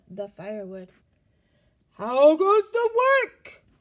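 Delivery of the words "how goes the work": slow, high, loud drawn-out call; calling farmer. A narrator's reading. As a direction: stretch the call out lazily from bed. Farmer calls again.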